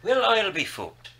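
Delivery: high-pitched mock Irish brogue. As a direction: toss out the catchphrase in an Irish accent. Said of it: "Irish brogue".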